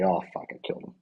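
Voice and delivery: breathless, stunned whisper; a soldier whispering over a body he just killed; horrified whisper. Retold or imitated. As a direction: whisper it in shock. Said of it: "Captain America's gonna be like".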